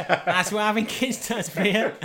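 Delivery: loud, high, slow, laughing delivery. Real, not acted. Host joking to his co-host about fatherhood, laughing loudly. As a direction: crack up while drawing the line out.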